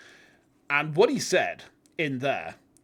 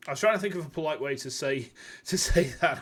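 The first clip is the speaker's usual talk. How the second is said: usual speaking voice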